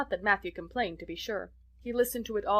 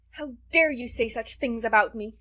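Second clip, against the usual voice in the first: louder, higher furious demand; vehement; 3 semitones higher, 6 dB louder than usual. A narrator's reading. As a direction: throw it back at her. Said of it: "she repeated vehemently".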